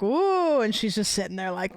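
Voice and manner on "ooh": high, loud mock delight; playful ooh for the dog. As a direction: savour it like a chef. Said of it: "each one I'll be like".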